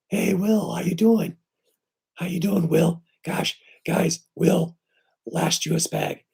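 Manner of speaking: excited greeting. Real, not acted. Greeting viewers in live chat.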